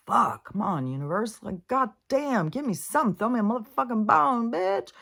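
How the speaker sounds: high, loud, anguished mock outcry; desperate yell at the universe. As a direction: cry out to the sky in despair. Retells yelling at the universe.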